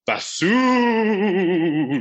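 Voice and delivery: slow, drawn-out spooky answer. Pretend. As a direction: linger on every word like a ghost. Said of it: "I'm getting the hang of this spooky voice".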